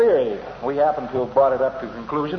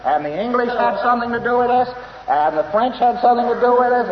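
higher and louder, insisting everyone shared the blame; a hammed-up objection, voice pitched up; mock protest; 8 semitones higher, 4 dB louder than usual. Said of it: "You say".

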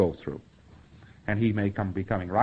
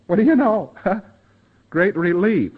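high, loud delighted surprise; surprised relief; 8 semitones higher, 8 dB louder than usual. Retold or imitated. Lecturer voicing pre-clear's amazed relief. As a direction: play the pre-clear amazed it finally works.

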